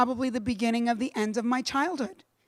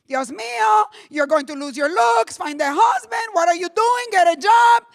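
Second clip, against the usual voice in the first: higher, louder nagging parents; frantic parents; 8 semitones higher, 7 dB louder than usual. Retold or imitated. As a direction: scold like panicked parents. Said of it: "my parents were losing it".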